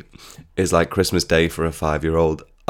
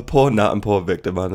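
ordinary talk

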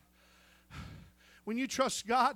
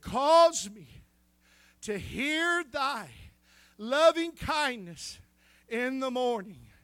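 drawn-out psalm; slower than usual. Acted. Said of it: "preacher drawing out the psalm".

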